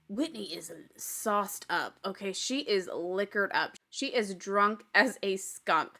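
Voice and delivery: drawn out, low and deliberate; deliberate emphasis. Real, not acted. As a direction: spell it out slowly, word by word. Spelling out how drunk Whitney is.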